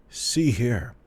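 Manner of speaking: put-on high, sudden old man voice; old man announcing. A narrator's reading. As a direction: burst out as a cranky old man. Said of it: "the old man announced suddenly".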